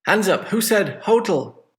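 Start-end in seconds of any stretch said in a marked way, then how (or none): 1.0-1.7 HO-tel, with HO punched high; stress on first syllable. Shown as a demonstration. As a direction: say hotel with the weight on HO. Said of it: "stress, on the first syllable".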